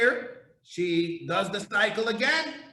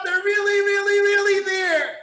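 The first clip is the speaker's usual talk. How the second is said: rising excitement; 7 semitones higher, 6 dB louder than usual. Real, not acted. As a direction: voice climbing higher and louder with excitement. Preacher building to climax.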